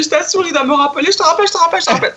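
high, fast, rushing to hang up; insist, then rush the goodbyes; frantic insisting. Retold or imitated. To her friend on the phone, rushing her off the line, high and fast. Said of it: "Je fais".

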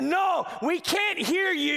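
the people begging Moses; frightened refusal. Retold or imitated. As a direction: back away in fear. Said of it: "they said".

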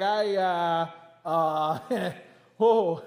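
stammering, halting trucker at a speech; a tongue-tied trucker who can't find words; flustered trucker. Retold or imitated. Preacher imitating a trucker stumbling before Congress.

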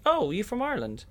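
high, curious "oh, are you from Ireland?"; a curious, high question; mock surprised question. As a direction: play the delighted casting panel. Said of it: "we instantly get asked the question".

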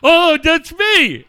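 loud, high, drawn-out cry; hammed-up shout of recognition; mock dawning realisation. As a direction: wail it slowly in comic horror. Acts out friend's sudden realisation.

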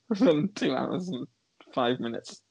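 ordinary talk

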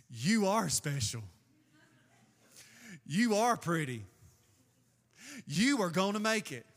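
put-on a light Southern accent on a mirror affirmation. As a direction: say it like a Southern self-pep mantra. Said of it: "I don't know why I'm speaking Southern".

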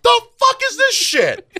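very high, loud, slow outraged yelp; mock outraged heckler. Imagining heckling the opera, a high mock yell. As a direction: shriek it like a heckler in the opera seats. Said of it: "that asshole in the crowd, like".

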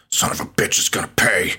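hushed, breathy mock-tough biker line; gruff biker whisper. Podcaster quoting Boozer's threat in a mock gruff whisper. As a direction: whisper it like a hammed-up tough guy. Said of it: "Boozer's like".

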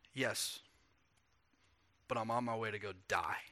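soft and low, the weight of it; quietly telling his friends he'll die; hushed and grave. Acted. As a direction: let it drop to almost nothing. Jesus's grave reply, voiced.

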